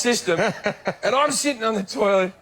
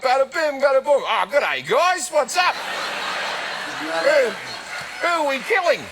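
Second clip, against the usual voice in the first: higher mock mobster voice breaking into a shout; Aussie Goodfellas gangster; 7 semitones higher, slower than usual. Comedian imitates an Aussie gangster walking into Goodfellas, hammed-up mobster voice. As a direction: ham up a gangster who is an Aussie at heart. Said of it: "an Australian character in Goodfellas".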